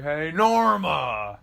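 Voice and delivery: loud, drawn-out mock holler; calling out. Mover on the couch calls the old lady, mock holler. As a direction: holler the name slowly across the house.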